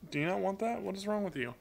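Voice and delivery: high, fond scolding of a bird; talking to a pet. Real, not acted.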